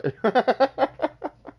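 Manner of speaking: a big high burst of laughter; loud high laugh. Real, not acted. Laughing at his own joke, loud high laugh. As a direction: crack up loudly and high.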